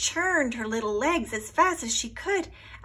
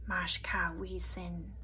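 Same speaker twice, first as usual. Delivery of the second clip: whisper; 3 semitones lower, 7 dB quieter than usual. A narrator's reading. Storm voice echoed.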